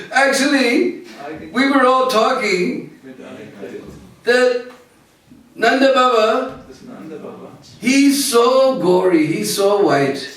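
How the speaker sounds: high, giggling teasing voice; high boys laughing as they tease; mocking cowherd boys. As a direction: taunt him with the silly story. Boys' mocking tale.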